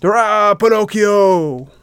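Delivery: high, stretched cheer of the crowd; cheering shout. A narrator's reading. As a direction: shout the name out in triumph. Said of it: "began to shout".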